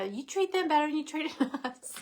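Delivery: playful imitation of envious children; jealous kids. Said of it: "my kids are jealous of my animals".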